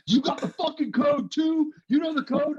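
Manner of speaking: high, loud accusing yell; angry yelling. Retold or imitated. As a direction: yell it like an angry squad leader. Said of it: "and they're".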